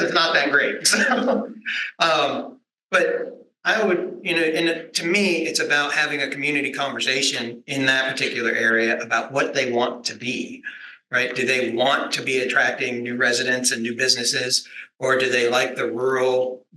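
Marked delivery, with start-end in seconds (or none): none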